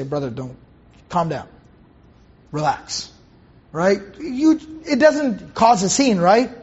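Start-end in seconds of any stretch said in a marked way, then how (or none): 0.0-3.1 low, soft mock reassurance; calming down. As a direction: play it hushed and low, easing someone down. Imagined calming of an agitated brother.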